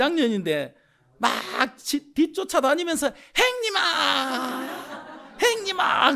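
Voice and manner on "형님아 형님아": put-on high, laughing child's call; little kids calling. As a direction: mimic tiny kids chasing a big brother.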